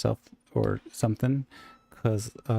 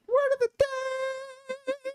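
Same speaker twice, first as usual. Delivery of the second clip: slow, much higher excited call; announcer shout; much slower than usual. Acted. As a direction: call it out like a game show host. Echoing the announcement.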